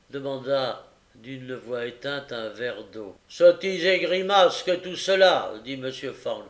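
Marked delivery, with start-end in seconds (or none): 3.3-5.5 loud, high scoff; contemptuous magistrate, voice raised; scornful dismissal. A narrator's reading. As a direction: dismiss it all loudly. Magistrate dismisses boy's plea.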